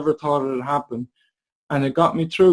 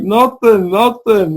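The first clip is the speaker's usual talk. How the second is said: higher and louder, a quick double protest; excited denial; 7 semitones higher, 7 dB louder, slower than usual. Retold or imitated. Telling mom on the phone he did nothing, excited loud denial. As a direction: brush it off, pitched up and loud.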